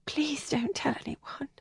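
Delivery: a breathy, hushed plea; pleading whisper. Acted. Helen begs Kathleen in a pleading whisper. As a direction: beg her to keep the secret, whispering.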